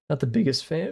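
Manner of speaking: cracked, breaking voice. Real, not acted. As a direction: voice cracking on biggest. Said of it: "Ooh, voice crack".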